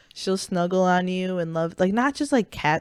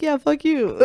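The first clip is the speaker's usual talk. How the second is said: much higher, snarky imitation; mock ungrateful retort; 9 semitones higher than usual. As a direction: toss it back mockingly. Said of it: "You're like".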